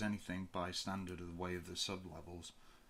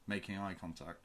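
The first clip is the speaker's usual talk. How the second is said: plain reading voice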